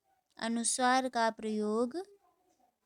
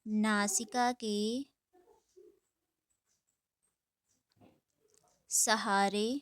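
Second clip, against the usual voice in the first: slow dictation; slower than usual. Shown as a demonstration. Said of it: "dictating word by word".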